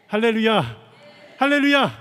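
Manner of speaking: loud, high preacher's acclamation; rallying hallelujah shout. Real, not acted. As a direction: call out to rouse the congregation. Preacher rallies congregation with hallelujah.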